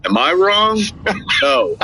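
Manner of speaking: normal talking voice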